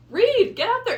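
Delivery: high, loud cheer; rally the audience to go read; excited urging. Real, not acted.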